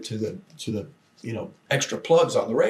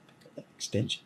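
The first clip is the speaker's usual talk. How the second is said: whisper; 2 semitones lower, 9 dB quieter, faster than usual. Retold or imitated. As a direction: whisper it so the caller can't hear. Secret instruction during phone call.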